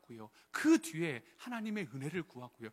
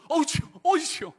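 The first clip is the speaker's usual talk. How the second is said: surprised exclamation; 10 semitones higher, 10 dB louder than usual. Retold or imitated. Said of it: "그래도".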